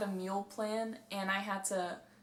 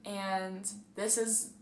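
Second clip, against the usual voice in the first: ordinary talk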